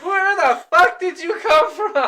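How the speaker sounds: high, loud incredulous yell; outraged disbelief. Retold or imitated. Retelling outrage at the wasp.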